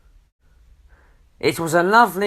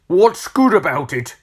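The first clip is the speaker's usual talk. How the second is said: louder, higher grumpy challenge; storybook grump, indignant; grumpy retort; 5 semitones higher, 5 dB louder than usual. A narrator's reading. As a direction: throw the greeting back crossly. Mister Grumpy rejects the greeting.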